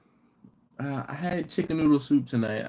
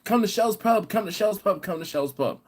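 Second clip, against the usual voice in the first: mock Chris inviting; 8 semitones higher than usual. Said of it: "was named Shell".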